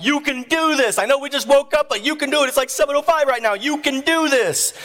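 high-pitched pep talk; urgent encouragement. Retold or imitated. Husband at wife's head during labor, urgently encouraging. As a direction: cheer her on earnestly.

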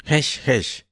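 a hunchback shooing a rat; shooing hiss. A narrator's reading. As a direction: shoo the rat away with a hiss. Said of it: "le chilló".

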